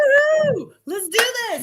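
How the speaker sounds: high-pitched gleeful cheer; excited whoop. Real, not acted. Co-host cheering as the trailer talk starts, excited whoop. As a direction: whoop it up, high, to kick things off.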